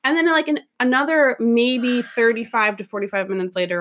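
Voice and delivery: usual speaking voice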